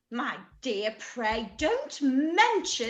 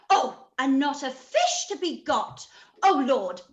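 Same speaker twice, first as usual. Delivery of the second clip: usual speaking voice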